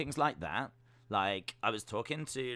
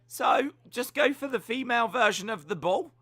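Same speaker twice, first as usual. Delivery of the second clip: excited rant; 11 semitones higher, 12 dB louder than usual. Real, not acted. Ranting about the team name.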